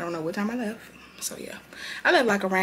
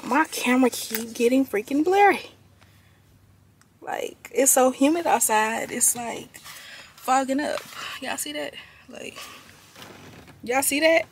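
excited; 5 semitones higher, 5 dB louder than usual. Real, not acted. Frustrated at blurry camera.

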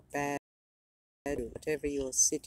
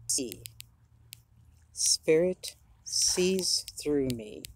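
solemn affirmation read with conviction; 4 dB louder than usual. Acted. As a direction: proclaim each line as spiritual truth. Reads a spiritual affirmation aloud.

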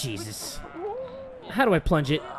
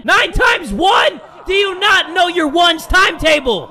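much higher, loud angry yell, nine times one drawn slow; furious shout; 10 semitones higher, 6 dB louder, slower than usual. Real, not acted. The dad screaming at his kid about the ones table.

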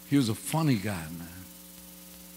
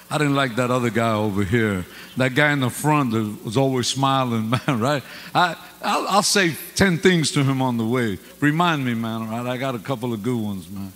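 louder, driving preacher voice; full-force preaching; 2 semitones higher, 8 dB louder than usual. Acted. Preacher joking with the congregation, louder full preaching. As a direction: preach it out to the whole room.

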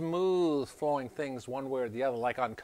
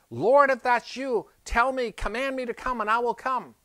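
eager call; 10 semitones higher, 7 dB louder than usual. Acted. Peter calling to Jesus on water.

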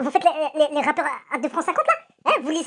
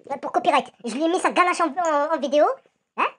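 ordinary delivery

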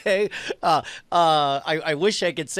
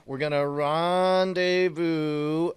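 drawn-out rendezvous; 3 semitones lower, 4 dB quieter, much slower than usual. Acted. Player assuring his partner they will meet up, drawn out slowly.

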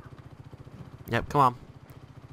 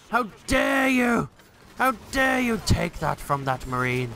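shaking with anger; 7 semitones higher than usual. Acted. Marine robbed of his vehicle.

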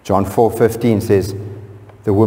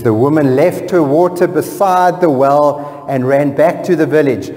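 impassioned preaching; 8 semitones higher, 3 dB louder than usual. Acted. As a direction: proclaim the verse with fervour. Preaching at full force.

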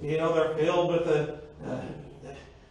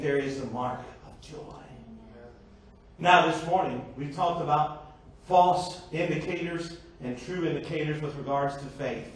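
ordinary talk, nothing marked

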